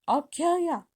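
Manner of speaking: high, loud threat; furious shout. Acted. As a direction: yell it as you grab them. Filch grabs and threatens.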